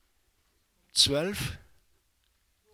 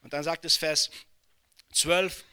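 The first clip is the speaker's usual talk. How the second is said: high, loud preaching at full force; rising sermon fervour; fiery preaching; 6 semitones higher, 6 dB louder than usual. Acted. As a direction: preach it out to the whole hall. Proclaiming Revelation's heavenly city.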